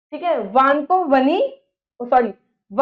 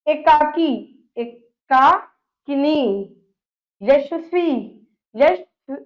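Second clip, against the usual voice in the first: slow teaching demo; much slower than usual. Shown as a demonstration. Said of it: "कैसे".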